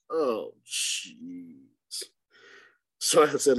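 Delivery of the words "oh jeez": low, soft deflated "oh, jeez"; retold dismay. Guide hearing where the client held, dismayed. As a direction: let it sink low, as if deflated.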